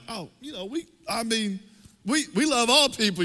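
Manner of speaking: high, halting backpedal; trying to save face. Retold or imitated. Pastor to the offended man, saving face with a high flustered stammer. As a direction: stammer upward in flustered placation. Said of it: "try to save face".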